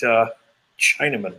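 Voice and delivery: ordinary delivery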